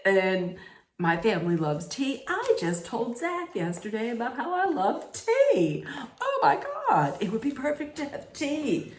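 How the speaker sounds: high, over-the-top mock excitement; gushing contestant. Greer bragging she told Zach she loves tea, hammed-up gushing. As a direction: imitate a gushing fan, voice leaping up.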